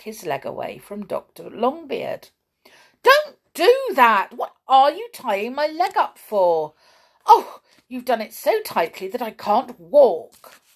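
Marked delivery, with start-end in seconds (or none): 3.0-10.6 high, loud indignant outburst; angry protest. A narrator's reading. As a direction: object loudly and crossly. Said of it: "said Perry angrily".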